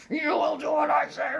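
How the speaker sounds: high, loud imitation of his demon voice; mock the husband's shouted demon voice. Imitating her husband yelling at the children in his demon voice. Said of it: "his demon voice".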